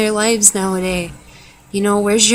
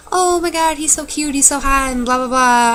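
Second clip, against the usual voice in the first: much higher, giddy mock gush; gushing girl; 7 semitones higher than usual. Vlogger mimicking girls gushing over a cute boy, much higher. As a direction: squeal like a boy-crazy teen.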